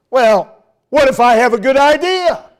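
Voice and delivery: high, loud whining question; mock a complaining churchgoer; whiny objector. Preacher mimicking a church member who wants to speak, whiny.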